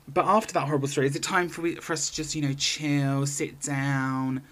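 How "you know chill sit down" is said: ordinary delivery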